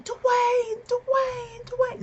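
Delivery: very high, repeated calling out; retold fangirl yell. Fan yelling to The Rock past security, high yell. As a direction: imitate a desperate scream for attention. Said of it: "I just start yelling".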